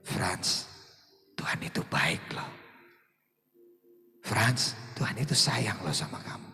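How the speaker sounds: low, hushed whisper to oneself; low whispered self-encouragement; self-talk whisper. Shown as a demonstration. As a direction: show them how to whisper to yourself. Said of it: "Sama-sama ya ngomong begini".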